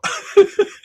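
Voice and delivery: high airy burst of laughter; can't-stop-laughing giggle; breathless laugh. Real, not acted. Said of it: "I can't stop laughing".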